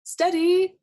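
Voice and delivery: high, loud steady call; Duck's loud high shout. A narrator's reading. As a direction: sing it out to the jumpers. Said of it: "shouted Duck".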